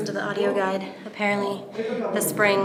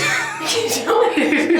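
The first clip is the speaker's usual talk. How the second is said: laughing; 9 semitones higher, 8 dB louder, much slower than usual. Real, not acted. Amazed at endless tunnel.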